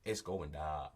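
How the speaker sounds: low echo of "down" as "dah"; imitated Texan pronunciation. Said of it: "the N on the end of that".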